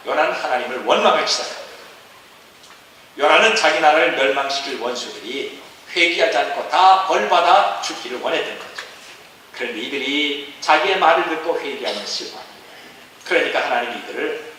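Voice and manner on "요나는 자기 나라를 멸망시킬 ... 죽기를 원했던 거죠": ordinary talk, nothing marked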